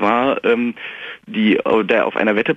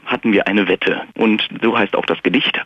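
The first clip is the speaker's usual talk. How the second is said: usual speaking voice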